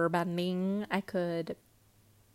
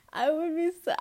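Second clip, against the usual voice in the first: mock crying; 9 semitones higher than usual. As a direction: whine it like a fake sob. Imagines crying over bad bending.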